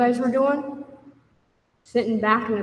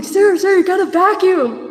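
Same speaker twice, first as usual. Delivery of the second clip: higher and louder nagging call; 9 semitones higher, 8 dB louder than usual. Retold or imitated. Housemates waking Sarah for chores, loud nagging call. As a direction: call her name up the stairs, insistent. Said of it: "they come up and like".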